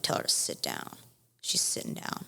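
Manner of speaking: very low, soft, near-whispered retort; hushed exasperation. Retold or imitated. Bride to Lexi before the wedding, hushed and exasperated. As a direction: mutter it under your breath, out of patience.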